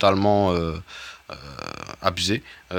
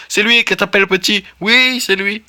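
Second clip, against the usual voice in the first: much higher, loud squeaky protest of innocence; mock-innocent game character; 12 semitones higher, 6 dB louder than usual. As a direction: play the guilty creature swearing it wasn't him. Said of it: "c'est pas très, très, très convaincant".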